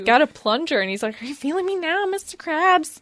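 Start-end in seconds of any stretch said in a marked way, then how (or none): 1.2-3.0 lightly voiced creepy kid; a hint of creepy meme kid. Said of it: "That's why I said it that way".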